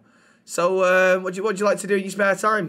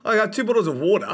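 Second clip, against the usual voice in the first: Australian accent; 5 semitones higher, much faster than usual. Shown as a demonstration. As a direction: put on a lively Australian accent.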